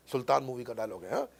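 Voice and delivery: ordinary talk, nothing marked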